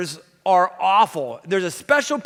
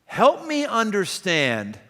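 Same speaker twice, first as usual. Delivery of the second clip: slow, flat help me understand as a demand; slower than usual. Shown as a demonstration. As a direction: put them on their heels, curt and slow. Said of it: "here's the same words, different tone".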